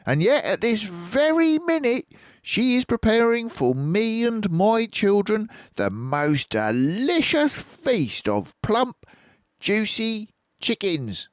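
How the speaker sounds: hint at a secret; sly Fox voice. A narrator's reading. Fox tempting with feast.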